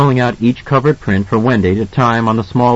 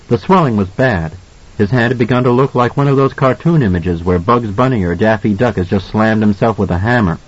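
ordinary delivery, nothing marked

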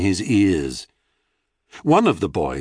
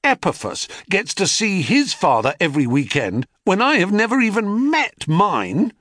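put-on a boy's resentful whine, rising high at the end; boy complaining; 9 semitones higher than usual. A narrator's reading. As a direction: voice the sulky son, pitch climbing. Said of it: "he would complain to his mother".

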